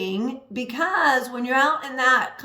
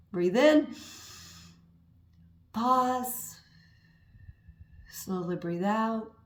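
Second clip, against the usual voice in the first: soft, slow breathing cue; calm breathing guide; 6 dB quieter, slower than usual. Shown as a demonstration. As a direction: lead the breath in, hold, then let it out slowly. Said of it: "take a few really deep breaths".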